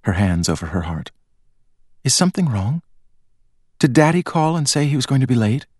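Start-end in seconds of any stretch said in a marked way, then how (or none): 2.0-5.7 put-on pleading girl. A narrator's reading. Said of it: "Charlene pleaded".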